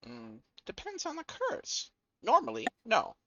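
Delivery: game master voicing a high-pitched character; in-character answer. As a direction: put on a high voice to answer the question.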